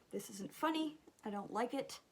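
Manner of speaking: ordinary delivery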